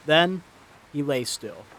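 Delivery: plain reading voice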